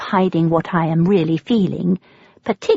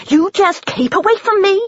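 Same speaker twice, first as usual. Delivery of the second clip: much higher and louder, jumping back; frightened yell; 13 semitones higher, 4 dB louder than usual. A narrator's reading. Woman jumps back from stranger, yelling high and loud. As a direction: shout to keep her at arm's length.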